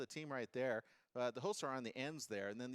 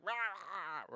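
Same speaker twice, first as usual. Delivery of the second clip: mock chef yelling; 5 semitones higher, slower than usual. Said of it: "he's like".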